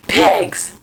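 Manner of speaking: loud, forceful burst on pigs; spat-out insult. Retold or imitated. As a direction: blurt pigs out hard and loud. Venting about the police.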